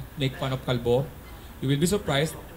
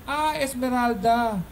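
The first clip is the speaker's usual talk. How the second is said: nasal-voiced guy; 9 semitones higher, much slower than usual. Nasal character finally says name.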